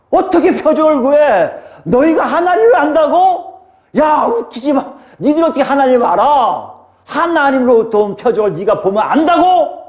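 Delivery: high, indignant challenge; forceful rebuke. Retold or imitated. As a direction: retort as an exasperated Jesus rebuking doubters. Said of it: "더 굉장히 강하게 얘기하는".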